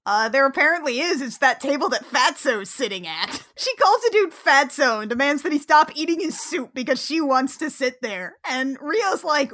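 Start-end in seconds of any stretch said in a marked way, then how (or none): none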